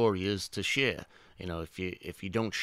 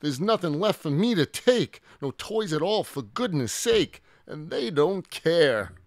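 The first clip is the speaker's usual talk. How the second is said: high, loud distressed complaint; upset little dinosaur, high-pitched; shaken upset; 9 semitones higher, 6 dB louder than usual. A narrator's reading. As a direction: lament it in a raised character voice. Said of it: "The Minosaur began to shake".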